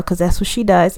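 normal talking voice

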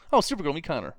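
high, loud sing-song intro; breezy cheerful introduction; mock introduction. Repeats mocked introduction.

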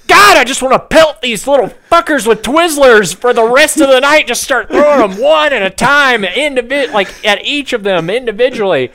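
high, loud mock frustrated rant; inner rant. Annoyed moviegoer's inner voice, high mock rant. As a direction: vent like an exasperated grown man. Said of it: "I'm just like".

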